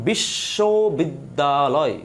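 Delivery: "বিশ্ববিদ্যালয়" stretched slowly into separate syllables. Shown as a demonstration. Teacher demonstrating how a word is pronounced, syllable by syllable. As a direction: draw out each syllable of "বিশ্ববিদ্যালয়". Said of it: "আমি একজন উচ্চারণ করছি".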